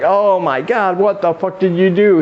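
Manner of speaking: high, aghast outburst, acted out; mock-horrified exclamation; horrified woman. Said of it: "And she's horrified".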